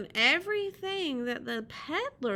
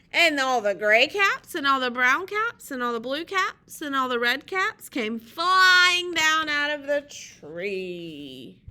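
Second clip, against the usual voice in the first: loud, building list, stretched at the climax; animated picture-book narration; story read-aloud; 8 dB louder than usual. A narrator's reading. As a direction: tell it big and swooping for children.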